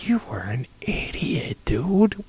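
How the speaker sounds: whispered insult; hushed scornful aside. Acted. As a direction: hiss it quietly.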